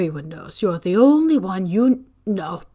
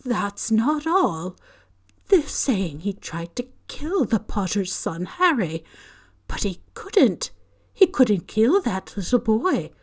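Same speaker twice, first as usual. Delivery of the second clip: ordinary delivery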